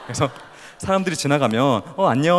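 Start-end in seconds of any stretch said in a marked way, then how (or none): 2.0-2.4 loud, higher-pitched casual hello; casual greeting. Acted. Showing how people normally greet passers-by, casual greeting. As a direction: toss out an easy, lively hi. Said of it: "이렇게 인사하면 되잖아요".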